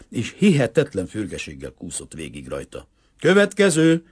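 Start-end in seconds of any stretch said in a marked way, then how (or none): 3.2-4.0 loud, high command; shouted order. A narrator's reading. Boy captain summons the next climber, shouted order. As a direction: yell it like a captain. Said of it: "ordította Lubovszki".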